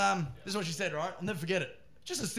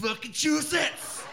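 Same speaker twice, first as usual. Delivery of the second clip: high, emphatic cry splitting the word; gleeful shout; 9 semitones higher than usual. Retold or imitated. Comic echoes the Americans' state, gleeful shout. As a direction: crow it out with relish.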